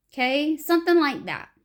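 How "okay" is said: ordinary talk, nothing marked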